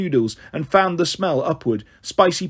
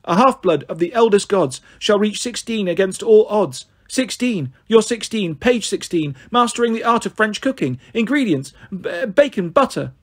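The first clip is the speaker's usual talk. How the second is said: lightly chanted prophecy, drifting; reciting; 4 semitones higher than usual. Acted. Ella recites a prophecy to the sky, a mild sing-song. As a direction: recite to the clouds as if entranced. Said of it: "started reciting to the clouds".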